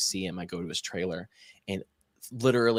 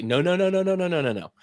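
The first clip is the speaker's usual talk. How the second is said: quick string of high-pitched noes; a run of noes pitched up and a bit louder; inner panicked refusal; 8 semitones higher, 3 dB louder, faster than usual. Retold or imitated. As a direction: refuse in your head, pitched up and insistent. In my head, I was like.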